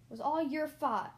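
loud, sharp accusation; barking. A narrator's reading. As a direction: bark the blame at him. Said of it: "Grandfather William Shortpaws barked".

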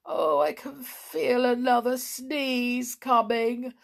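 slow, high, woeful whine; doleful. A narrator's reading. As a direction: moan dolefully as a sneeze builds. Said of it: "said Perry dolefully".

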